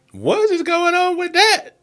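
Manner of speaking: high-pitched and slow, genuinely baffled. Real, not acted. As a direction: squeal it out in disbelief at the screen. Reacting to a strange TV scene.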